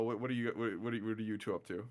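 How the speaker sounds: ordinary talk, nothing marked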